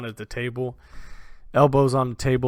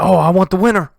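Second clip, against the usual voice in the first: Abram's challenge; 7 semitones higher, 11 dB louder than usual. Acted. Abram voiced, drunk bravado.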